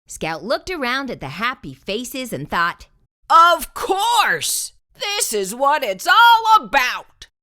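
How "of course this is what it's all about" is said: loud, high, thrilled exclamation; overjoyed triumphant shout; joyful shout. Acted. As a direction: cry it out with delight at the happy faces. Scout's joyful realization.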